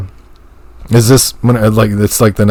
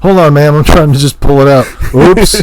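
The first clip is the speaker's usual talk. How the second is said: mock plumber; 6 semitones higher, 3 dB louder than usual. Plumber voiced joke.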